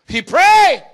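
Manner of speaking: sudden high shout of he prayed. Acted. Preacher to church, a sudden high shout. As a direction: burst out loud and high. Preacher bursts out after pause.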